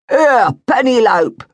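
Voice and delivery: loud, high call across the street. Acted. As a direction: hail her from a distance. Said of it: "called Mister Barker".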